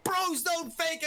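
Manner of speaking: high, hype shouted call; esports caster. Real, not acted. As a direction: yell the clutch, voice soaring. Round-winning clutch in live commentary.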